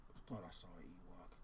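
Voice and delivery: whispered to himself; I thought I saw an Ewok, whispered. Real, not acted. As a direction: mutter it under your breath. Muttering to self while searching.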